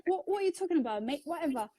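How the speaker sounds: imitating her early London-accented English, pitched high; high mock London accent, said with a grin; fake London accent. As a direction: ham up a cocky Londoner with a learner's English accent. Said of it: "This was my accent when I first learned English".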